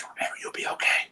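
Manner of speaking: whispered, you'll be okay; whispered encouragement from the boss; whispered reassurance. Retold or imitated. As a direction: lean in and hush it to calm him.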